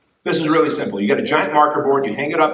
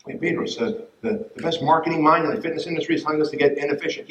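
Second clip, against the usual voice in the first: usual speaking voice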